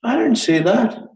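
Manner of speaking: high-pitched mock protest; imitating an indignant denial; acted denial. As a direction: deny it as someone caught out. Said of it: "They'll say".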